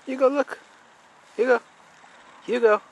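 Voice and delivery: high sing-song pet voice; calling the dog. Real, not acted. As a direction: coax the dog, high and bright.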